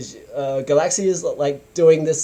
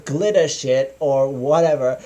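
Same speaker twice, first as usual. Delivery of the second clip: usual speaking voice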